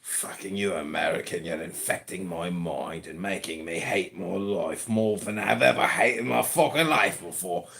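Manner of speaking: low, quiet British grumble; put-on British accent. British puppet grumbles at an American, low British accent. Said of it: "the British put out a lot".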